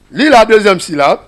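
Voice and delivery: loud call to start the reading game; teacher's game command. Shown as a demonstration. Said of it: "Alors, on va lire". (ordre du maître, fort, pour lancer le jeu)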